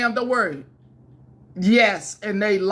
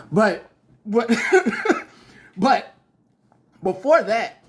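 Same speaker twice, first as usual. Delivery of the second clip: laughing; 4 semitones higher, 4 dB louder than usual. Real, not acted. Laughs at own joke.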